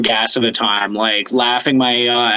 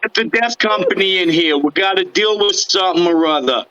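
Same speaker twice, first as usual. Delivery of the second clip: higher, casual Brooklyn wiseguy; a hint of Brooklyn accent, higher; 6 semitones higher than usual. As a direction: shrug it off like a Brooklyn guy. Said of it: "talk like they're from, uh, Brooklyn".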